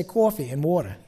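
Jersey vowels in "coffee" and "water"; North Jersey accent. Shown as a demonstration. Preacher teased by out-of-towners, North Jersey accent. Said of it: "we in North Jersey have an accent".

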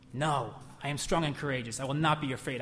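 ordinary delivery

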